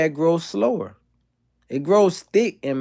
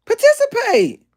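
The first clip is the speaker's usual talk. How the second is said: much higher, louder shout; urgent shout; 5 dB louder than usual. Real, not acted. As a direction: yell at chat to join in. Urging the chat to answer.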